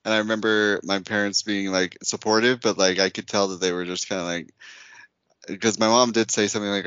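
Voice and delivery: ordinary delivery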